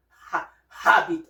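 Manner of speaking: "habit" with the H breathed out; wrongly sounded H. Shown as a demonstration. Teacher shows what we don't say, "habit" with sounded H. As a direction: start "ha-, habit" on an audible H. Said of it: "we don't say".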